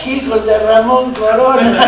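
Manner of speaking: loud, high, drawn-out bullring yell; mock taunt shouted at full voice; imitated crowd shout. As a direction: holler it like a jeering crowd in the stands. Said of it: "le gritaban".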